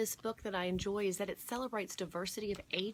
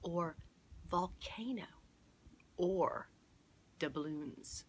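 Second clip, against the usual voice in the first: 1 quietly; much slower than usual. Acted. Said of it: "Mister Haggerty would say quietly".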